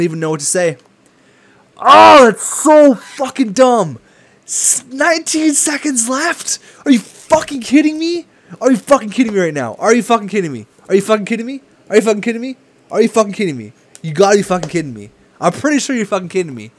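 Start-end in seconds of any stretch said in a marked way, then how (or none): 1.8-8.3 high, loud furious yell; furious outburst. Real, not acted. Gamer raging at a last-second goal, loud and high. 8.6-16.7 repeated angry disbelief, raised pitch; angry rant. Real, not acted. Gamer ranting at the game over a loss, angry.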